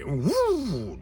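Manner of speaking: drawn-out mouthed swoosh; radio sweeper whoosh. Retold or imitated. Lecturer mimicking radio station transitions, long mouthed whoosh. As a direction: imitate a station jingle effect. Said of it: "When you listen to a radio station".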